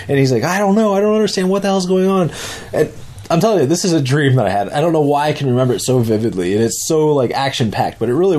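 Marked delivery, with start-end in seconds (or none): 0.4-2.3 bewildered. Retold or imitated. Friend's bewildered reply.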